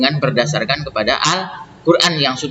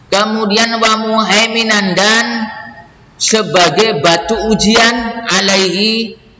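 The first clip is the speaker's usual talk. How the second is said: raised, slow, measured recital of verse and gloss; verse reading; 7 semitones higher, slower than usual. Acted. Teacher reading scripture and translation for students, slow measured recital. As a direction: read each phrase deliberately in a lifted voice.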